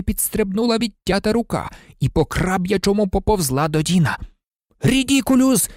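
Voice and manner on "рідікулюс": high-pitched bellowed spell, mildly done; mild high yell; Dean's holler. A narrator's reading. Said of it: "загорлав Дін".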